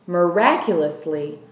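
usual speaking voice

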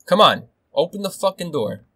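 high, loud, exasperated demand; shouted challenge. Real, not acted. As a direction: goad them loudly to come in. Same dare, now shouted in frustration.

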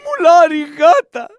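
high put-on woman's voice keening the dead, slow at the start; hammed-up widow's lament, crying out the losses; mock lament. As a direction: told to cry, she wails a mock dirge for her husband. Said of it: "Κλαίγε, είπαμε να κλαις".

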